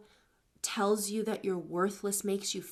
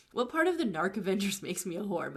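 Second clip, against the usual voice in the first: normal talking voice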